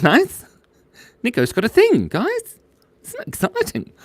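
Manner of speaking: high cartoon squeal; high squeaky voice. Excited high reaction to chat.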